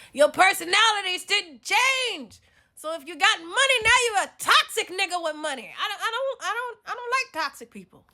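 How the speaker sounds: high-pitched exasperated shouting; loud high rant. Acted. Telling off a toxic man with money, shouting high. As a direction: tell them off at full volume, voice way up. Ranting at a newly rich man.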